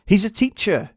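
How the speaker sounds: high, loud call from the crowd; called-out answer. Acted. Someone in the crowd calling out who he is, loud. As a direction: shout your answer over the noise.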